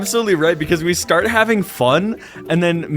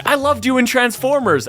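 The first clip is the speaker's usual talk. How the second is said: high-pitched fanboy dad; high, adoring fan voice; starstruck; 10 semitones higher than usual. Retold or imitated. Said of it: "they were equally starstruck".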